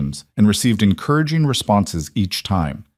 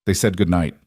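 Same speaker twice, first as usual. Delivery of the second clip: ordinary delivery, nothing marked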